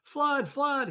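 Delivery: very high, loud alarm cry; cat crying flood. Acted. Jack tries to scare the birds off, very high loud alarm. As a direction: yell the warning in panic. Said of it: "he cried".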